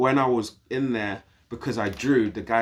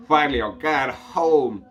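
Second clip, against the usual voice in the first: relieved excitement; 5 semitones higher, 6 dB louder than usual. Retold or imitated. Excited to leave prison.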